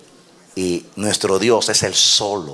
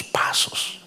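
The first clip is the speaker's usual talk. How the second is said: 1 whisper; 13 semitones higher, 5 dB quieter than usual. Real, not acted. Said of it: "sermon emphasis".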